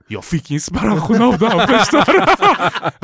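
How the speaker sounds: high, loud laughing brag; real laughter breaking through. Real, not acted. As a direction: crack up mid-brag. Boasting about bouncer job, laughing.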